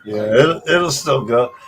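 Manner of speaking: usual speaking voice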